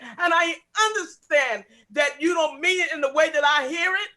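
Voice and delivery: ordinary delivery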